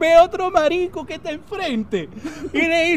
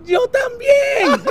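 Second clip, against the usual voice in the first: very drawn-out high holler; calling back; 9 semitones higher, 3 dB louder, much slower than usual. Acted. Friend answering from the far balcony, calling back. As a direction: stretch it out high across the gap.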